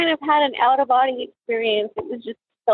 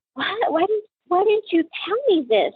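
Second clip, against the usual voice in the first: high-pitched, incredulous retold questioning; shocked disbelief; 9 semitones higher than usual. Mother confronting the neighbor who stayed silent, shocked. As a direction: demand an explanation in upset disbelief, without shouting.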